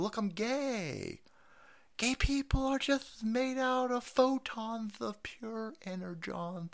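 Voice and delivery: low, soft lisping caricature; mocking lisp. Host plays the official claiming innocence, mocking lisp. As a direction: lisp through it in mock self-importance.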